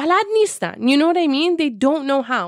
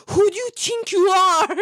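pushy guy; 7 semitones higher than usual. Retold or imitated. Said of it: "He's like".

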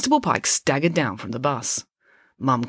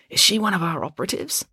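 ordinary delivery

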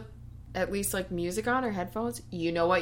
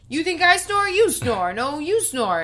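higher, louder mock bickering; 8 semitones higher, 5 dB louder than usual. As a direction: toss it back and forth.

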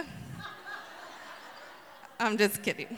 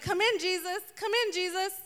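giddy welcome; 6 semitones higher than usual. Pretend. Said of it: "She was like".

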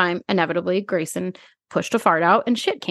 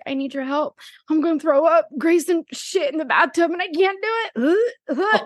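higher, near-crying panic; desperate plea; 9 semitones higher than usual. Retold or imitated. Calling her new boyfriend for help, higher and near tears. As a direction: beg for rescue on the verge of tears. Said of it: "I'm like".

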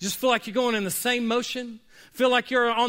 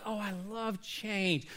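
put-on soft, slow, contented baby voice; quiet blissful baby; 10 dB quieter, slower than usual. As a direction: play the calm baby, much softer.

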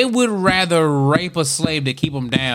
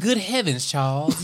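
softly scandalized Southern belle; hushed, genteel Southern exclamation; Southern belle accent; 5 dB quieter than usual. Acted. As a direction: play a proper Southern plantation lady. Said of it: "Do it again".

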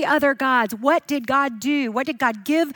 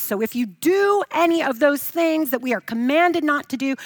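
ordinary delivery

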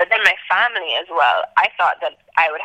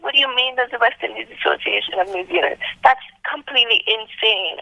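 retold disbelief, pitched higher; mind blown; 6 semitones higher than usual. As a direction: question it in amazement, voice raised in pitch. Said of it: "I was so mind blown".

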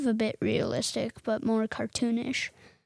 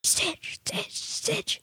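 whisper; 9 semitones higher, 6 dB quieter, slower than usual. Real, not acted. Kid whispering favorite name.

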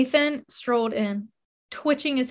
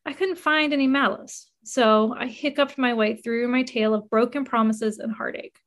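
plain reading voice

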